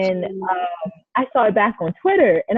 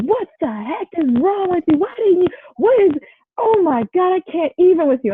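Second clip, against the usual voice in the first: exasperated coach; 11 semitones higher than usual. Retold or imitated. Coach scolding wrestler after match, exasperated high-pitched rant. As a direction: play the coach losing it over her, pitch way up.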